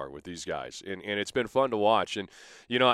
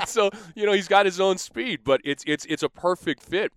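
higher, louder words still full of laughter; laughing through words; 10 semitones higher, 7 dB louder than usual. Real, not acted. Guest still laughing after his joke, higher and louder. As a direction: keep laughing as you talk.

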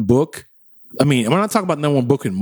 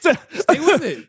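high slow drawn out; 13 semitones higher, much slower than usual. Pretend.

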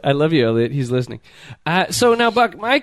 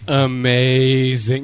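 very slow, low, drawn-out swoon; low, savoring catchphrase imitation; Elliot; 4 semitones lower, much slower than usual. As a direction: stretch every syllable right out in mimicry. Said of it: "You know, Elliot does that".

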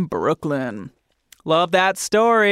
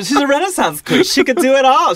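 high, loud, laughing praise; laughing and gushing; 10 semitones higher, 6 dB louder than usual. Real, not acted. Host gushing about her guest while laughing.

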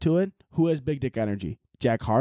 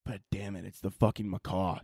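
much softer, lower relief; deflated mutter; 4 semitones lower, 8 dB quieter than usual. Pretend. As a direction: mutter it, let down. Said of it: "and I'd be like".

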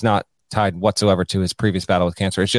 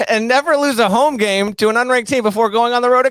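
high, loud, indignant lisping schemer; Princess Bride Vizzini; 3 dB louder than usual. Retold or imitated. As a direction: scold like a smug Sicilian mastermind. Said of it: "Princess Bride vibes".

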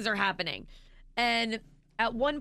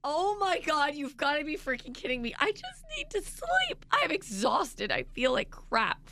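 exhausted whine; 7 semitones higher than usual. Retold or imitated. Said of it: "I was like".

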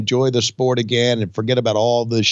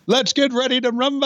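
ring announcer; 11 semitones higher than usual. Pretend.

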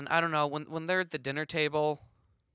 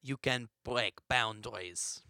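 put-on French accent; 5 dB louder than usual. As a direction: pronounce it grandly in a French accent. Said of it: "my French accent isn't that good".

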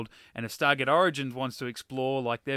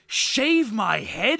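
very slow, higher mock disbelief; incredulous tone; 7 semitones higher, 6 dB louder, much slower than usual. Recalling the Body Swap line, slow stunned disbelief. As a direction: drag it out, stunned. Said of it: "It had that sort of tone to it".

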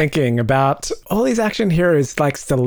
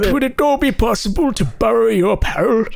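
high, well-spoken British action hero; 9 semitones higher than usual. Acted. Action hero politely asking for clothes, mock British accent. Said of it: "like a very British voice".

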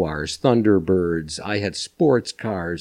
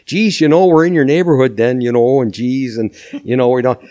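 higher, louder hammed-up Minnesota accent on the phone; 4 semitones higher, 4 dB louder than usual. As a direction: lay the Minnesota accent on thick to sell the housewife. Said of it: "really went into the accent".

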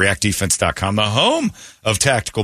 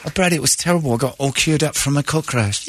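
higher-pitched mock Englishwoman; imitated English accent; 6 semitones higher than usual. Host as the woman telling Brady of her car crash, mock English accent. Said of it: "There she was talking about her car crash".

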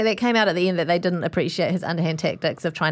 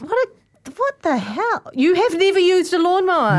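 high, rising outburst of disbelief; scandalised, pitched way up; incredulous; 9 semitones higher, 3 dB louder, slower than usual. Real, not acted. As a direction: build from stunned stammer to loud astonishment. Shocked at never having mowed.

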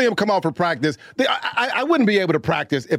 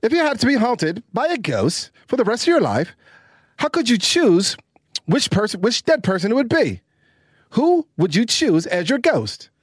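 put-on English accent, higher and lilting; 6 semitones higher than usual. Shown as a demonstration. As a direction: read the question in an amateur English accent. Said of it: "do this in an English accent".

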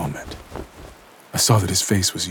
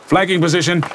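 sharp barked command, higher; snapped order; 10 semitones higher, 4 dB louder than usual. A narrator's reading. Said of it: "snapped the central agent".

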